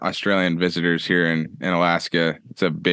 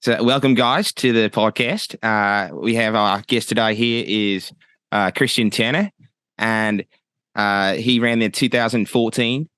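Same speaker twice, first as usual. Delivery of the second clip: put-on Australian accent with lifted phrase endings. Shown as a demonstration. Podcast host introducing his Australian guest, in an Australian accent. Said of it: "can I give you my best Australian accent".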